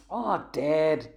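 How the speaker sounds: a groaning laugh at a dad joke. A narrator's reading. Jack reacts to Dad's joke, laughing. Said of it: "Jack laughed".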